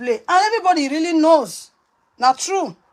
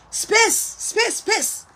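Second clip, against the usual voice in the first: space, space, space, pitched way up; high pleading space; 9 semitones higher, much slower than usual. Real, not acted. Wife on live video begging everyone for space, high pleading cry.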